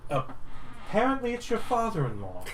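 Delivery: high wry paladin read; a hint of a wry paladin; lightly voiced paladin. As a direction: deliver the reveal dryly. Paladin voiced reading paper.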